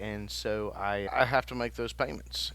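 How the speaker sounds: usual speaking voice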